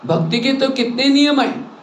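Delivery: normal talking voice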